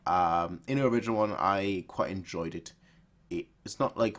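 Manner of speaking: ordinary talk, nothing marked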